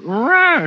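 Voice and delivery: sharp high roar as he throws the punch; retold punch roar. Throwing a punch at a bar, a short roar. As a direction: let out a quick battle cry. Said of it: "I just went".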